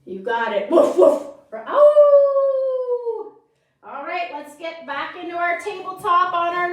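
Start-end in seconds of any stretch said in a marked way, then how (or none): 0.7-1.2 loud, playful woof, woof; louder barking woof; dog bark. Shown as a demonstration. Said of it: "what does the dog say". 1.7-3.3 dog howl. Shown as a demonstration. Dog sound after woof.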